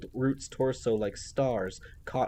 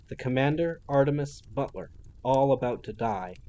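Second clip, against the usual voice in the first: ordinary delivery, nothing marked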